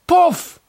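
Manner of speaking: high, sharp poof; magic poof. Acted. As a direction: pop it out like magic smoke. Genie magic in joke.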